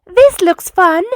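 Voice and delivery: eager delight at a new toy; excited. A narrator's reading. Bus JJ spotting the new sand pit, excited. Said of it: "thought J J excitedly".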